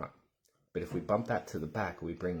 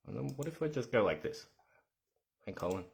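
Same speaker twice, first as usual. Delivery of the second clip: ordinary talk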